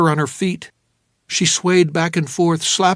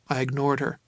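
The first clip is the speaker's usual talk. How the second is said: usual speaking voice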